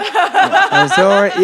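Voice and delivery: very high, slow, laughing parody; squeaky mocking voice. Mocking the text.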